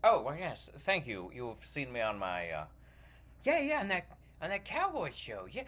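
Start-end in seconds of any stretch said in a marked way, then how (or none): none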